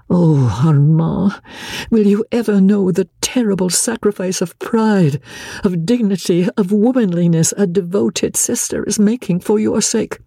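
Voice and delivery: an understated inner lament for her brother; lightly voiced anguished sister. Marguerite's anguished inner cry.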